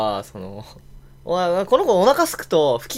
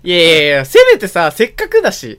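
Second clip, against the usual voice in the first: protesting loudly; 3 semitones higher, 10 dB louder than usual. Retold or imitated. I urge my friend to speak English, loud mock protest. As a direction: urge him, half laughing, to try English. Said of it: "みたいな".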